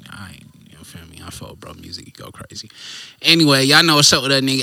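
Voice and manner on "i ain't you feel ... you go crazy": soft unvoiced whisper; whispered aside. Real, not acted. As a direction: drop to a whisper.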